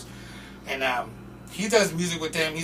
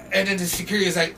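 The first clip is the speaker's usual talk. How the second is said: ordinary delivery, nothing marked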